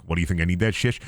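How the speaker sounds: usual speaking voice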